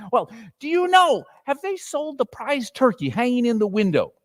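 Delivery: high, quick delighted orders; gleeful old man bargaining; excited Scrooge. A narrator's reading. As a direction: rush the boy off gleefully. Scrooge gives eager orders.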